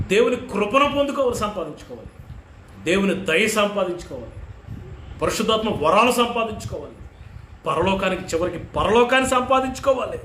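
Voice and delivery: ordinary delivery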